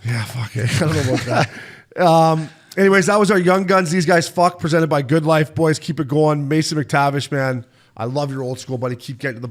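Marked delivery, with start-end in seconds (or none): 3.1-5.5 hyped-up sign-off read, higher and louder; podcast host plugging the segment; segment announcer read. Acted. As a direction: punch it like a show segment intro.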